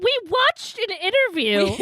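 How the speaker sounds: protesting disbelief. Retold or imitated. Protests the faked death, retold.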